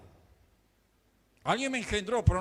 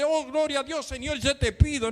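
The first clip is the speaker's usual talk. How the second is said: mock praying; 8 semitones higher than usual. Acting out a rushed prayer.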